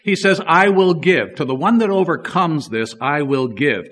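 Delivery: loud, ringing proclamation of God's promise; forceful preaching. Acted. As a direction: declare it loud to the congregation.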